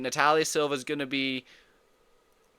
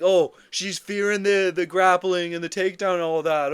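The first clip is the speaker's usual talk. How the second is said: mocking; 3 semitones higher, 6 dB louder than usual. Mocking others' excuse.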